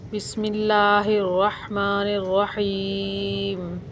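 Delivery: slow, chanted tajweed recitation of the basmala; Quran recitation. Shown as a demonstration. Teacher recites the basmala for her students, slowly chanted. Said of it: "जैसे मैं आपको पढ़कर अभी बताती हूँ".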